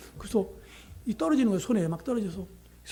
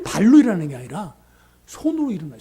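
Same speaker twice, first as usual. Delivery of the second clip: usual speaking voice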